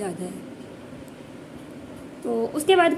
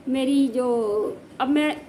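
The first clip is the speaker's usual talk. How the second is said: usual speaking voice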